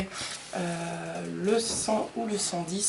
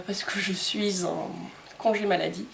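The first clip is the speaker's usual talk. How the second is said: ordinary talk, nothing marked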